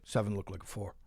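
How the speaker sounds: low, quiet, flat mumble; mumbled. Retold or imitated. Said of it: "He just mumbles".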